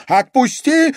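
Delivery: high, loud squeal; panicked high cry; shrieking. A narrator's reading. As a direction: shriek to be let go. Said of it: "взвизгнула дама теней".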